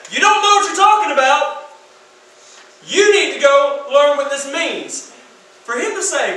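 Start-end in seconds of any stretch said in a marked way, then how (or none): 0.1-5.1 loud, high rebuke, slowing on the last line; forceful rebuke. Acted. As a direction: preach it as a stern rebuke. Jesus confronting the teachers.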